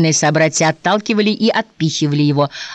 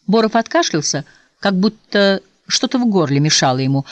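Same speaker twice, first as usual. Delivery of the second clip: usual speaking voice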